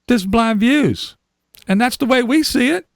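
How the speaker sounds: podcast host outro; show sign-off. Acted. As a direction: announce the show's closing line.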